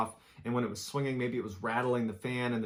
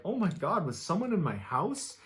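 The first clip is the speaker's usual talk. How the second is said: higher-pitched, lightly panicked quote; mildly acted alarm; 6 semitones higher than usual. A narrator's reading. Reddit user after hearing the recording, lightly acted alarm. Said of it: "the Reddit user is like".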